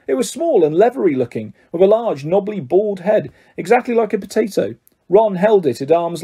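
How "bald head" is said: plain reading voice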